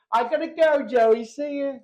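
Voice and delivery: hammed-up cheery goodbye; calling goodbye. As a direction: imitate a nurse calling out as she leaves. Nurse calls goodbye, acted.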